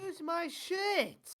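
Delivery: high, slow, exasperated yell; frustrated outburst. Real, not acted. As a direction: lose it at the game.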